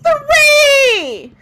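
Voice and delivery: very high, loud drawn-out yell; hammed-up interrupting holler; Zoe yelling out. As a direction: blurt it out in a long high shout. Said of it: "and then Zoe yells out".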